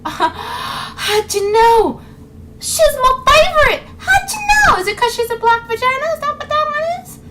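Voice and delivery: very high, hammed-up squeal; mock gushing. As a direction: fake tearful delight, sarcastically overdone. Sarcastic fake delight at assumption.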